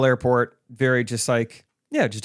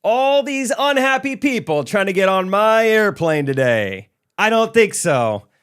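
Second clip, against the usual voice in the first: high, slow, theatrical attendant voice; sassy flight attendant; 8 semitones higher, 5 dB louder, slower than usual. Retold or imitated. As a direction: camp it up like a showman. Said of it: "and he said".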